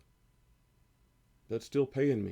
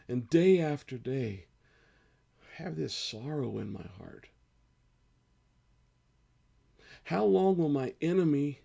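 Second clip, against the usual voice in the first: slow, pleading psalm reading that swells louder and higher on each how long; slow emotional scripture reading; 4 semitones higher, 3 dB louder than usual. Acted. Pastor reading Psalm thirteen aloud to his church, slow and pleading. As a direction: read it like a lament, aching and crying out to God. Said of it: "I'm gonna read it one more time".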